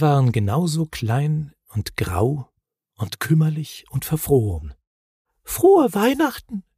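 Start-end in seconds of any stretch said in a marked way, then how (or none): 5.4-6.6 tiny, very high voice; timid little creature. A narrator's reading. The little Knütt greeting the Moomins, tiny high voice. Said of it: "flüsterte das Knütt".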